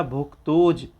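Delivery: भुक्तोज read as one unit with a clear bh; careful recitation. Shown as a demonstration. Teacher leading the class through भुक्तोज. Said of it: "यहाँ तक आप लोग पढ़िएगा".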